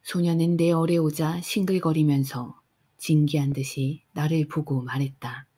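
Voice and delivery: ordinary delivery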